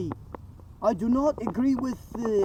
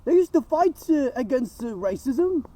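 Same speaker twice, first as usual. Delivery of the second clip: wild unhinged ranting; 4 semitones higher than usual. Real, not acted. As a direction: rant it out, pitched up and shouting. Escalating outraged rant.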